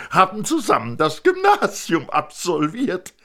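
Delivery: a proud father showing off his family; thin man presenting wife and son; eager thin man. Acted.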